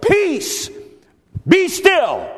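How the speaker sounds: very high, ringing shout of command; shouted order, a pause between commands; firm authoritative cry. Acted. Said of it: "cried out with a firm and authoritative voice".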